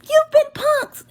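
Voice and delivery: high, slow, loud TV-prank shout; spring the prank reveal like a host; squealing slow You've been punked; mock announcer reveal. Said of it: "just be like".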